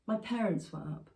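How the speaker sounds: ordinary delivery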